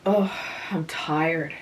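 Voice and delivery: slow, put-upon sigh of a complaint; petulant exclamation. A narrator's reading. Frances flops on the grass, petulantly tired. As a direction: drag the words out like a sulky grown girl. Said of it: "exclaimed petulantly".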